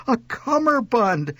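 high, drawn-out delighted exclamation. A narrator's reading. Mother thrilled by her first cummerbund, delighted. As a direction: marvel slowly at the word. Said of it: "Her face lit up like an Italian sunrise".

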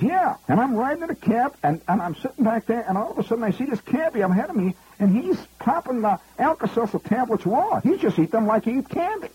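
high, brash Bronx New York voice; Fordham Road Bronx accent. Retold or imitated. Tipsy executive boasting to comic, in Bronx accent. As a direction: drop the English polish, go full Fordham Road. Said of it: "he's talking like he's from Fordham Road".

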